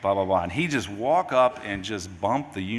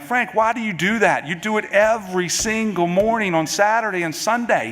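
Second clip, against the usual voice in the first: much higher, louder mock-exasperated complaint; 12 semitones higher, 8 dB louder than usual. Father retelling complaining to his son, mock exasperated. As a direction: throw up your hands, high and pleading.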